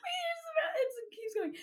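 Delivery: very high, squeaking through laughter; real laughing fit while talking; helpless laughter. Real, not acted. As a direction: barely get words out for laughing. Laughing at endless texts.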